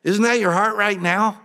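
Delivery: high, loud, fast devotion; eager disciple. Acted. Disciple answering Jesus about sleeping rough, high eager. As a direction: brush off hardship, keen and high. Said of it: "the Son of Man has no place".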